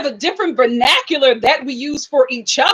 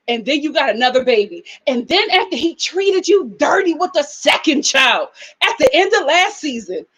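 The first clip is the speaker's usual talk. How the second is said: ordinary talk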